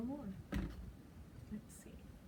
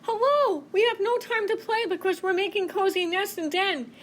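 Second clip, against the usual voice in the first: very high, busy chipmunk; squeaky little critter in a hurry; very high, hurried animal voice; 10 semitones higher, 4 dB louder than usual. Acted.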